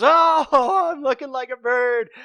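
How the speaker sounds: high, loud gleeful oh; delighted rhino. A narrator's reading. Rhino trying on branch wings, delighted and high. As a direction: gush over the new wings.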